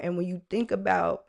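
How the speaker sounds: normal talking voice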